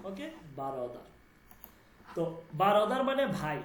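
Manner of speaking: normal talking voice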